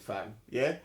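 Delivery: ordinary delivery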